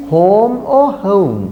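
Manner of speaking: HOME said two ways, plain long vowel, then gliding OH-oo; contrasting vowel sounds. Shown as a demonstration. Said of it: "The correct pronunciation is o".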